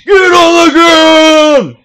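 very high, loud, drawn-out yell; overloaded mock-action bellow; freaking-out shout. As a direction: scream the command over the top, stretching each word. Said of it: "I'm, like, freaking out way too much. Look at this audio".

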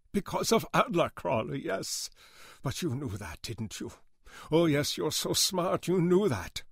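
put-on high, accented, bitter sarcasm; bitter rage. A narrator's reading. Older man accusing Goodman of knowing about camps, bitter rage. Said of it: "Weissman's face twisted in trembling rage".

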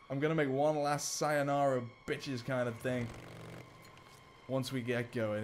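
ordinary talk

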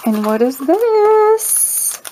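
high, slow, drawn-out wonder; surprised gasp. Real, not acted. Opening a friend's gift parcel, slow and high with wonder. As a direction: stretch it out in delighted surprise.

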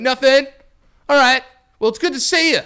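high, loud mock shouted small talk; yelling across street. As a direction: call back casually from over the road. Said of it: "yelling at each other from across the street".

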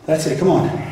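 normal talking voice

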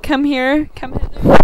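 building hammed-up summons; mock summoning call. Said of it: "the one that's like".

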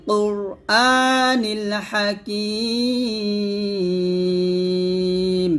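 slow drawn-out tajweed chant; Quran recitation. Shown as a demonstration. Teacher chanting the verse for students, slow and melodic. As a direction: recite it slowly, stretching the vowels.

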